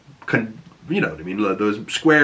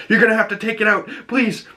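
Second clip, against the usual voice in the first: desperate plea; 11 semitones higher, faster than usual. Retold or imitated. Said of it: "pulls up her jacket and says".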